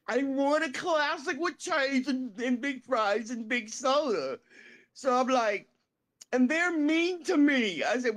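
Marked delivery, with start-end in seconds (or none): 0.1-4.4 high put-on plaintive voice; Roger impression. Homeless man ordering at the counter, high impression. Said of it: "Roger talks like this". 6.3-7.8 high, slow whiny complaint; Roger impression. Homeless man complaining about restaurant staff, high slow whine. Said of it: "Roger talks like this".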